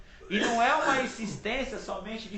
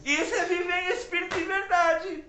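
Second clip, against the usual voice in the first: much higher and louder, at full force; preaching shout; 11 semitones higher, 6 dB louder than usual. Real, not acted. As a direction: proclaim it loud and high. Preacher rises to a high point. (pregando em voz alta e aguda)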